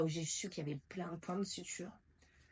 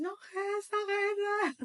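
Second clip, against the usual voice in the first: high, pleading cry to her mother; panicked plea; 13 semitones higher, 3 dB louder, much slower than usual. Retold or imitated. In labour, begging her mother not to leave: panicked high plea. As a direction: beg her to stay, voice pitched way up.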